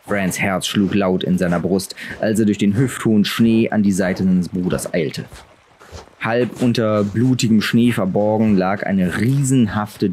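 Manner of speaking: usual speaking voice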